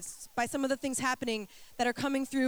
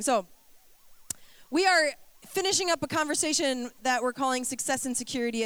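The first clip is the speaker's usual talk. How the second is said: ordinary talk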